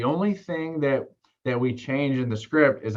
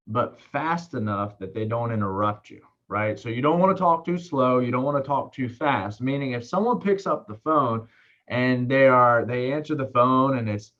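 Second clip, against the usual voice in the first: normal talking voice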